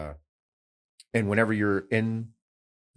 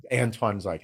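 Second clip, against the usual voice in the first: ordinary talk, nothing marked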